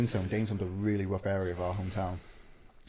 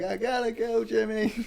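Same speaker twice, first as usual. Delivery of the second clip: much higher, hammed-up panicked voice; frightened Karen; 12 semitones higher, slower than usual. Storyteller mimicking Karen to the stranger, hammed-up and high. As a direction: squeak it out like a scared wife, laughing. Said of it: "and I'm like".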